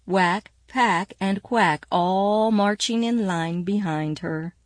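ordinary delivery, nothing marked

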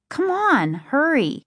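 high-pitched, pressing command; impatient urging. A narrator's reading. Sister handing her brother paper towels, impatient urging. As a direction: hustle them along in a high voice.

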